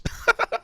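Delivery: squeaky high burst of laughter. Real, not acted. Fan cracking up at Negan's kitchen joke, high squeaky laugh.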